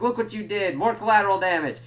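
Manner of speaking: mock scolding. Nandor scolding mid sword fight.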